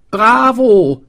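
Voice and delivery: high, loud bravo; cheering call. A narrator's reading. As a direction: cheer him on. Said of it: "rief der Schneidermeister".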